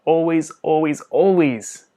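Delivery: insistent triple always; emphatic repetition. Real, not acted. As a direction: plead it, always stacked on always. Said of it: "emphatic plea".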